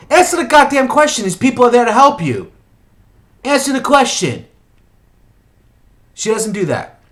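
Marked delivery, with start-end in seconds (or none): 0.1-4.5 high, loud exasperation; raised-voice, indignant demand; exasperated scolding. Acted. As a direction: tell off a character who won't listen. Scolding the character for not answering.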